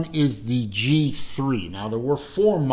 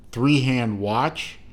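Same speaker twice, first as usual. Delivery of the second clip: ordinary talk, nothing marked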